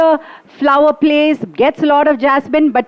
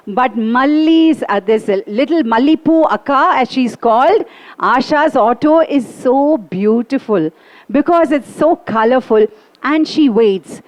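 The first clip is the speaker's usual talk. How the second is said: ordinary delivery, nothing marked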